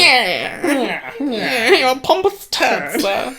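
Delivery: high, loud, drawn-out put-on voice; hammed-up haughty insult; words thrown up. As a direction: heave it out like retching up an insult. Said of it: "needs to be, like, thrown up somewhere".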